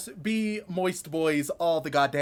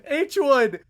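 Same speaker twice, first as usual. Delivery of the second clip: ordinary delivery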